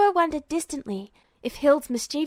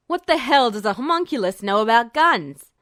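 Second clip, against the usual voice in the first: scoffing alchemist; 6 dB louder than usual. Acted. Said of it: "Mathry scoffed".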